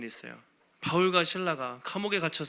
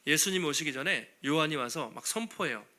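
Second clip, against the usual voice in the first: usual speaking voice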